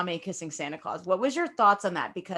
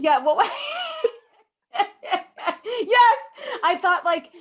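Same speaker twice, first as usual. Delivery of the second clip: laughing high through the words; can't stop laughing while answering; real laughter; 12 semitones higher, 5 dB louder, slower than usual. Real, not acted. Telling friend the story, laughing through words.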